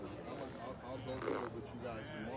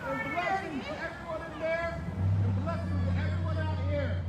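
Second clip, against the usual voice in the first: a light, slightly raised host announcement; announcer style; 11 dB louder than usual. Acted. Host introducing himself and his partner to the crowd, in announcer style.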